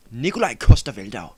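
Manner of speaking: loud, hammed-up Danish accent. Shown as a demonstration. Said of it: "I'll do the Danish accent".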